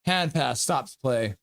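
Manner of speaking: announcer calling the clock; play-by-play. Acted. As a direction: count down the power play like an announcer. Live hockey play-by-play.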